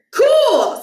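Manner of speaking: put-on a relieved boy; pleased kid. A narrator's reading. Stuart's happy reply.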